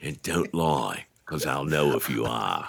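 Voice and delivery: a lightly voiced slow Aussie host; a hint of a sly Australian DJ; mock Australian DJ. [name] joking as the DJ, light slow Australian accent. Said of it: "continues DJ voice".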